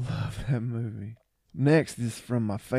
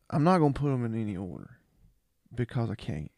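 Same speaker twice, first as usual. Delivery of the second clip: ordinary delivery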